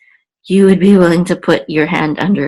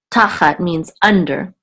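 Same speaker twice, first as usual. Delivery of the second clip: ordinary talk, nothing marked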